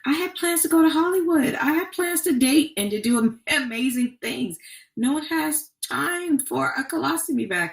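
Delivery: high, indignant disbelief at the news; incredulous teenage protest. Retold or imitated. Telling doctors no one has time for this, high protest. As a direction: pitch up high, protesting like her younger self.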